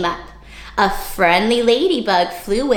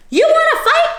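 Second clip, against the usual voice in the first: squeaky, much higher, louder taunt; grouchy challenge; 5 dB louder than usual. Acted. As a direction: dare them like a tiny bully. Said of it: "the grouchy ladybug".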